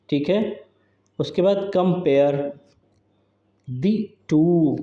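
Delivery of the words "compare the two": slow, broken into "compare", "the two", "a sum", "must be"; measured scansion reading, piece by piece; syllable by syllable. Shown as a demonstration. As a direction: pause after each small group of syllables. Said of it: "अब इसमें count करिए आप".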